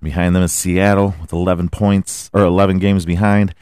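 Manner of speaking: ordinary talk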